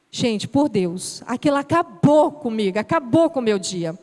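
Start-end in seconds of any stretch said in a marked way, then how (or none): none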